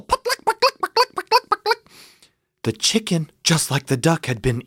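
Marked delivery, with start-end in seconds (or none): 0.0-1.9 very high, rattling chatter; hysterical guinea fowl squawk. Acted. Storyteller voicing upset barnyard guinea, hysterical squawking.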